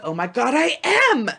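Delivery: hammed-up delight at being singled out; retold excited outburst; thrilled recognition. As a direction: climb to a high, loud exclamation.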